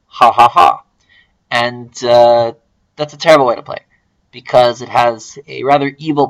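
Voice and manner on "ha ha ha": gloating mock cackle; hammed-up smug ha ha ha; pulsed ha sounds. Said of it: "they will play N seventeen thinking".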